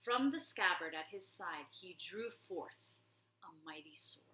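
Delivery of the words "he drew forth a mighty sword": hushed narration. A narrator's reading. Narrator hushing as the sword is drawn, soft whisper. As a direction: drop to a hush for the sword.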